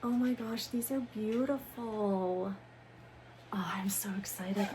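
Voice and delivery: hushed thrilled whisper; excited whisper. Real, not acted. Admiring the books.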